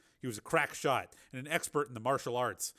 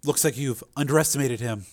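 Linda voice; 11 dB louder than usual. Acted. Linda needling.

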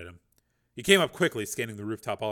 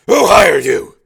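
higher, furious bellowed demand; angry shout; 8 semitones higher, 22 dB louder, much slower than usual. Acted. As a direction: roar the question at him. Dangling gunman over the edge.

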